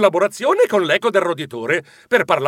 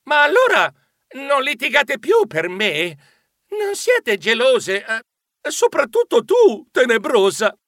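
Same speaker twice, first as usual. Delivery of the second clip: puzzled high questions, slowing; confused asking; 6 semitones higher than usual. A narrator's reading. Geronimo asking the two friends, confused and high. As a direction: ask in bewildered disbelief. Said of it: "Io chiesi confuso".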